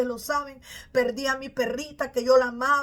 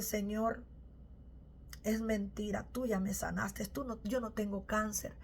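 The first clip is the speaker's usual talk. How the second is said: a soft, hushed plea under the breath; hushed prayer; 3 semitones lower, 11 dB quieter than usual. Retold or imitated. A woman secretly praying softly to the Lord beside her son. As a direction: pray it quietly, eyes shut, so the son won't hear. Silent prayer with eyes closed.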